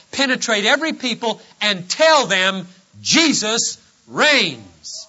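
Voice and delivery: emphatic, ringing proclamation; full-force preaching. Acted. Risen Lord commissioning his ambassadors, emphatic preaching.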